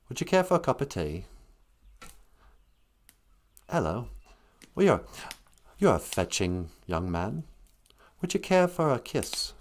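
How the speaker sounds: soft, lilting English gentleman; mock English accent. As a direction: put on a softly spoken English accent.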